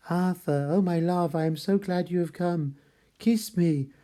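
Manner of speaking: lightly voiced Lucy reaching out for a last kiss; dying Lucy's loving plea. Dying Lucy begs Arthur to kiss her, lightly voiced and yearning.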